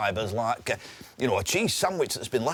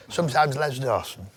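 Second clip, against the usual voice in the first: Les Dawson impression. Shown as a demonstration. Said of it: "Sometimes you do".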